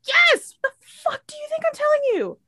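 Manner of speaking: screamed yes, very high and incredulous; furious caller. Acted. Caller to dispatcher asking if it was intentional, screaming furious. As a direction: explode with exasperation, voice shooting way up.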